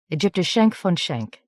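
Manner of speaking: plain reading voice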